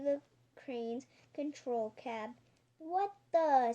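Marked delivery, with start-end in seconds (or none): 2.8-3.7 startled, higher and louder; surprised. A narrator's reading. Surprised crane operator as the monument is seized, startled. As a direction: blurt it in surprise. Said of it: "said the surprised crane".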